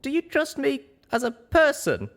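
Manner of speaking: high, urgent, earnest; pressing. A narrator's reading. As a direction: push the question harder, stress on you. Young pony pressing the question.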